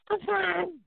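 high mock female voice; wife saying she's fine; imitating wife. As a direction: answer in her high voice. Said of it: "wife voiced high".

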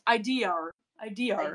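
"I D R", idea with an R on the end; mock Aussie "I D R" for idea; idea as "idear". Shown as a demonstration. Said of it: "you tack on an R".

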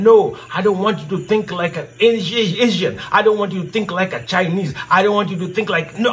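ordinary talk, nothing marked

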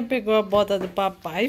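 normal talking voice

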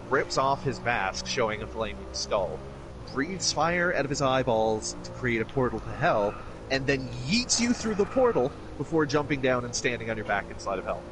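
ordinary talk, nothing marked

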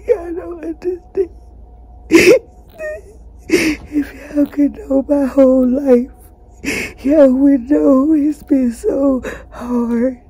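tearful pleading; crying, voice wavering; real crying. Real, not acted. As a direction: plead through tears. Crying to viewers about her life.

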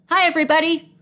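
calling out loud to a crowd; shouting. A narrator's reading. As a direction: shout a cheerful greeting. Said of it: "Jimmy was shouting to the crowd".

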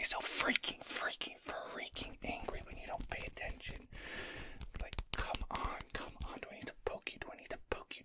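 ordinary delivery

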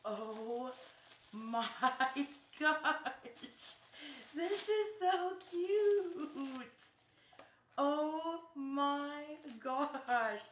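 high, loud, drawn-out gushing; delighted squeal. Real, not acted. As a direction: stretch the words out in thrilled delight. Delighted reaction on opening a gift.

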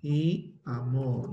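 very slow, drawn out; slow dictating voice; slow dictation. Shown as a demonstration. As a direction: stretch each word so they can write it.

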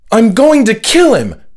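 very high, loud enraged yell; furious shout. Acted. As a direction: scream it in pure rage. Omar raging as door opens.

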